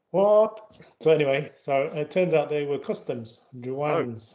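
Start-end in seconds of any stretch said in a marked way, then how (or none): 0.1-0.8 higher, louder retold "what?"; baffled reaction. Driver retelling his reaction to the police sign, baffled. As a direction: imitate your own bafflement, pitched up. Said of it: "I was like".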